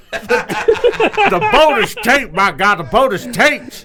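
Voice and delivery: slow, drawn-out announcer amazement. Acted. Commentator watching a commercial like a title match, awed hollering. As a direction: stretch the big call out slowly. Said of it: "us doing J R impressions".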